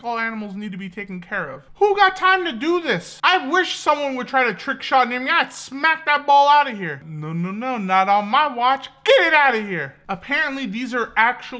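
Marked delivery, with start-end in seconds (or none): 1.8-9.9 loud, high playful trash talk; mock bravado. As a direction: call out like a cocky shot-blocker. Mock bravado at trick shot.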